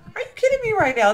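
high, loud, fast disbelief; excited squeal. Real, not acted. Repeating about the pool, loud high squeal of disbelief.

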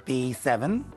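slow, measured bingo calls; bingo caller. Acted. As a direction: call each number out clearly with pauses.

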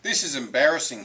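unhappy pig. A narrator's reading. Third pig complains about being pale, not happy. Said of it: "The third little pig was not happy".

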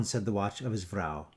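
plain reading voice

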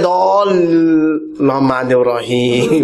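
mock a slow reciter, holding the syllables; dragged-out prayer syllables; very slow recitation. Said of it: "อ่านช้ามากเลย".

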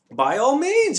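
bright, high and loud, beaming; happy reply. A narrator's reading. The Dodecahedron gladly offers help, beaming. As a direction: answer as if delighted to help. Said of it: "he replied happily".

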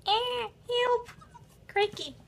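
high frantic cry; panicked shriek. Acted. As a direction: squeal for help. Townsfolk panic.

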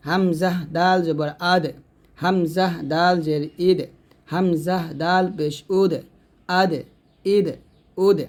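আদ, ইদ, উদ with a bouncing D release; qalqalah on Dal. Shown as a demonstration. Teacher showing students the bounced D of আদ, ইদ, উদ, qalqalah. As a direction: echo the final D in আদ, ইদ, উদ. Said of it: "দাল কিন্তু কলকল হবে".

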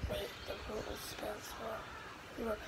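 hushed whisper to himself; whispered aside. Real, not acted. Gamer typing a word, whispered aside. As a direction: mutter it under his breath.

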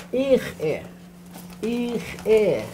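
"ich e" with a throaty ch and a catch before "e"; guttural glottal stop. Shown as a demonstration. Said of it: "guttural sound, and yet it has that glottal stop".

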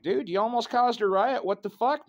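mock scold the clerk for the near riot; retold exasperated outburst.

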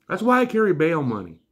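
usual speaking voice

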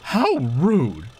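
indignant retort. Acted. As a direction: put him in his place. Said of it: "retorted Caroline".

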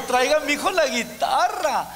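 mock eager granny. Grandma demanding the guitar.

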